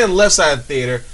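ordinary talk, nothing marked

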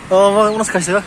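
ordinary talk, nothing marked

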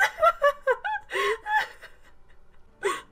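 laugh-like high crying; high tearful sobbing. Real, not acted. Viewer overwhelmed by the scene, high sobbing. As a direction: heave out high, broken sobs.

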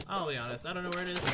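ordinary talk, nothing marked

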